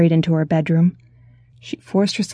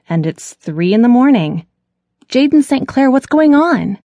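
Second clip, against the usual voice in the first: higher and louder, rising to a full-name scold; losing patience with her brother; exasperated; 4 semitones higher, 5 dB louder than usual. Acted. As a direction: sister snaps at her brother at three in the morning. Sister exasperated at odd questions.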